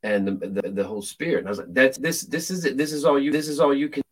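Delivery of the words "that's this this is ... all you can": higher, taunting jeer; mocking the demon. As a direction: scoff at him from above. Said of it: "I began to mock the demon".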